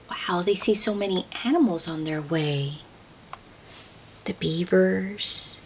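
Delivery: quick hushed whisper full of wonder. Real, not acted. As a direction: lean in and whisper the aside. Hushed aside during read-aloud.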